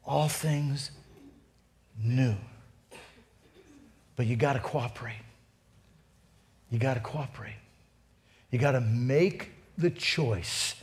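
low, soft, with long pauses; hushed appeal. Real, not acted. Pastor urging congregation to cooperate, hushed appeal. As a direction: urge them gently in a low, soft voice.

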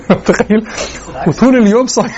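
real amused laughter in the words; chuckling at the absurdity; laughing. Real, not acted. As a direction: talk through a laugh. Joking about two-hour fast break.

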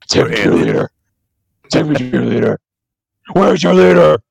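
booming alien demanding your leader; alien overlord. Pretend. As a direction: play a pompous invading alien. Alien voice after "be like.

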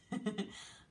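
short light chuckle; brief real giggle. Real, not acted. Teacher amused mid-lesson, short giggle. Giggles while drawing angry eyebrows.

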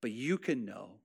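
soft closing preaching. Acted. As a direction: ease the room down and let each phrase land. Soft closing reading of psalm.

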